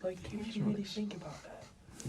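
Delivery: whispering. Real, not acted. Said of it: "why are you whispering?".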